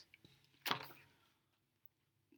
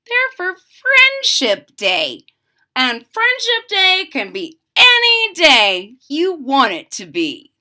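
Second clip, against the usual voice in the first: Franklin proud; 6 semitones higher, 5 dB louder than usual. Acted. Franklin's happy reveal.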